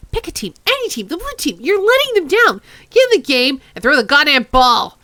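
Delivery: high, loud yelling at the players; frustrated yelling. Real, not acted. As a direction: bellow at them to play.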